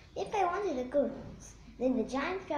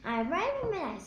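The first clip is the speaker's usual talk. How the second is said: ordinary delivery, nothing marked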